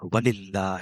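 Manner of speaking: very slow, drawn-out takbir; son's takbir. Acted. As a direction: stretch each word in praise. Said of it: "وہ کہتے ہیں". (بیٹے کی آہستہ تکبیر)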